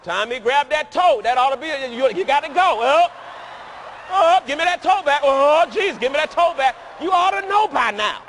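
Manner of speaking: loud, high teasing call-out; playful shout. Real, not acted. Preacher teasing Tommy, loud playful shout. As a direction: bark it at Tommy in fun.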